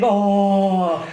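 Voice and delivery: long, drawn-out mocking "oh"; sarcastic. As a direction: imitate a heckler's sarcastic coo. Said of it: "started sarcastically saying".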